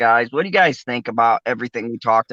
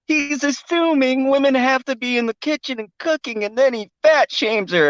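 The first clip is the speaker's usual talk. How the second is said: mocking the outraged critics; 11 semitones higher than usual. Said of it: "because they're like".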